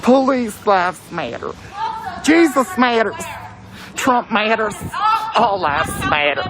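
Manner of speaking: mocking slogans. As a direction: call out each slogan in mockery. Mockingly calls out rival slogans.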